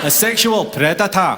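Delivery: put-on slow, savoured Welsh-accented echo; mimicked Welsh accent. Comic echoes the heckler's phrase, slow mock Welsh accent. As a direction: relish each word in mock Welsh. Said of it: "sounds better in your accent".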